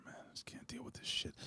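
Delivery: a real whispered grumble under his breath; a real muttered whisper while fiddling with the AC; whispered aside. Real, not acted. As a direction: whisper it to yourself, fed up. Grumbling aside about the cold.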